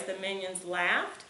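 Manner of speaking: the minions laughed, slow, ending on a rise; question tune. Shown as a demonstration. Mom demonstrating question intonation to her son, slow rise. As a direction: ask it with your voice alone, rising on laughed. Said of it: "we can just change your voice".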